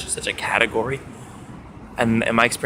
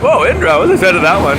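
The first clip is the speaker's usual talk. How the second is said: louder and higher, startled; surprised whoa; 6 semitones higher, 8 dB louder than usual. Retold or imitated. Meeting a man named Indra, loud surprised whoa.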